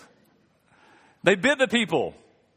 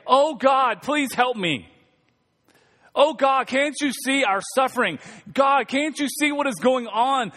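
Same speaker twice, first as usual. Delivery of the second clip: raised, pleading voice crying out to God; 5 semitones higher, slower than usual. Retold or imitated.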